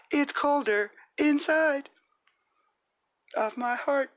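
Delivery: very high squeaky character; squeaky cartoon voice. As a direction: play a squeaky little character. High voice continues.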